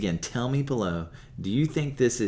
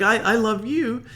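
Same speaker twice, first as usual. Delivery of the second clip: pleading; 13 semitones higher, 7 dB louder than usual. Retold or imitated. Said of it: "He's like".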